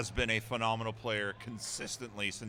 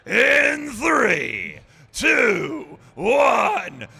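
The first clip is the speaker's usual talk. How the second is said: booming ring announcer countdown, much higher and louder; wrestling announcer voice; 13 semitones higher, 18 dB louder, much slower than usual. Acted. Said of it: "with your best wrestling voice".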